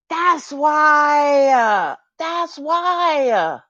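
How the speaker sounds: loud, high insistence; loud emphatic that's why; emphatic repeat. Real, not acted. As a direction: hammer the point home twice. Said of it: "repeats loudly for emphasis".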